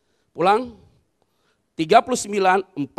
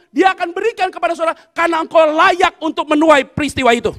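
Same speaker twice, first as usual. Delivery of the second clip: louder, driving sermon exhortation; preaching; 4 semitones higher, 9 dB louder than usual. Acted. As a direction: urge the congregation on with full pulpit force. Pastor exhorting congregation.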